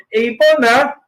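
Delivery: normal talking voice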